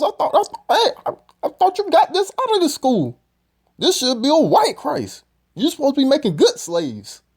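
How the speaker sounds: high-pitched mock outrage, mimicking the man in the clip.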